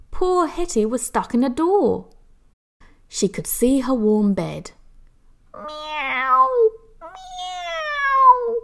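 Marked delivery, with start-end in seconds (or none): 5.5-8.6 a cat crying for help; crying meow. A narrator's reading. Hetty stuck in the door, crying meow. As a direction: meow rising very high. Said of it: "she cried".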